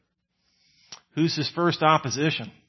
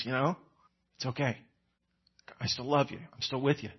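gentle reassurance; 3 semitones lower, 8 dB quieter, faster than usual. Retold or imitated. Kind friend consoles the failure.

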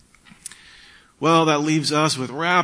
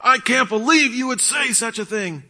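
high, outraged heckler outburst; indignant high-pitched yelling; acted angry yell; 10 semitones higher than usual. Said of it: "someone starts to yell really loud".

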